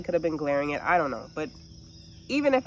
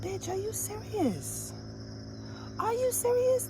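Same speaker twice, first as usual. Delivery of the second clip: high, slow disbelieving shout at the driver; are you serious, pitched sky high; outraged squeal; incredulous yell; 5 dB quieter, slower than usual. Retold or imitated. Yelling at other driver.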